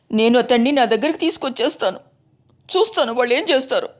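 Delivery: wife voice rising from pleading to defiance; pleading then defiant wife. A narrator's reading.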